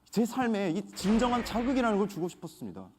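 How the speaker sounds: ordinary talk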